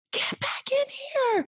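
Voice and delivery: very high, drawn-out mock yell; urgent holler. Mentor calls medical staff back to the room, imitated frantic holler. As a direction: screech it down the hallway, stretching the words.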